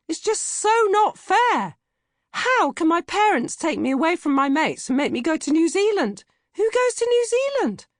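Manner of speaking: put-on high, loud teenage wail of injustice; whiny outrage. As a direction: whine it like the world is ending. Teen whining about moving away.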